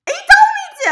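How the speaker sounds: very high, loud yell; exasperated outburst. Real, not acted. Insisting the order was his idea, exasperated yell. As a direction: cry it out in shrieking self-defence. Defends herself against his blame.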